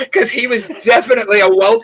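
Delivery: high, bursting laugh spilling into the words. Real, not acted. Host laughing while describing the stoned guest. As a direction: laugh through the line.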